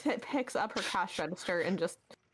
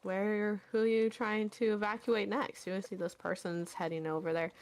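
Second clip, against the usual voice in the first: ordinary talk, nothing marked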